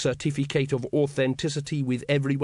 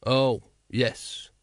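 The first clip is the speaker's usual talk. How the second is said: gloomily. A narrator's reading. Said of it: "said Vimes gloomily".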